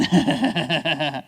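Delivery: imitated sneaky snicker; mock gleeful cackle. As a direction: secret laugh of a pleased prankster. Brother secretly laughing inside.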